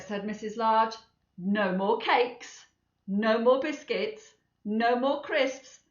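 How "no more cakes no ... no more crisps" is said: slow firm list. Acted. Mother listing, slow.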